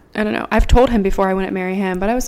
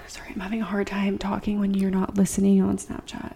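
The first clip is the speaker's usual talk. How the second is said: soft, breathy aside, nearly whispered; hushed aside; 10 dB quieter than usual. Real, not acted. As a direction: drop your voice to interrupt yourself.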